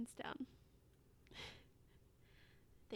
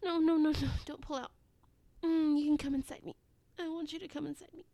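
moans growing louder and higher to a peak; building ecstasy; 4 semitones higher, 17 dB louder than usual. Acted. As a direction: let breathy pleading build into loud cries. Acted scene building to climax.

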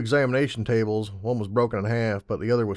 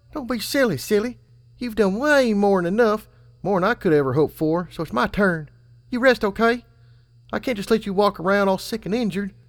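much higher, louder caring filly; insistent filly; 11 semitones higher, 5 dB louder than usual. A narrator's reading.

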